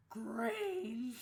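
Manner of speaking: loud, far-off zombie moan on the pun; zombie groan. Retold or imitated. Telling a zombie joke in class, in a loud zombie groan. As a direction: groan it like the undead.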